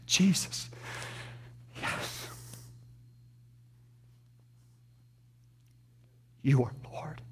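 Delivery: soft unvoiced whisper of devotion; whispered confession. Weak believer confessing to Jesus in a whisper. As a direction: breathe it like a private prayer.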